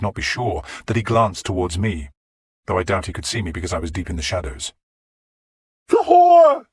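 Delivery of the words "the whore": high, loud shriek at the king; raving cry; shout. Acted. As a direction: scream it in his face. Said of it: "Godwin shouted at Alfred".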